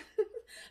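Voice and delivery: breathy laugh; a quick airy laugh. Real, not acted. Patient laughing about her shock, light airy laugh.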